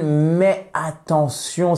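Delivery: usual speaking voice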